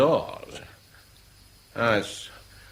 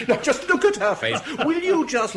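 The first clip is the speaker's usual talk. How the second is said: laughing; 13 semitones higher, 4 dB louder than usual. Acted. Teasing laughing about Natasha.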